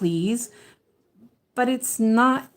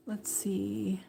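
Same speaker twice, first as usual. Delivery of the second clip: muttered to self; 2 semitones higher, 11 dB quieter than usual. Real, not acted. Counting stitches to herself.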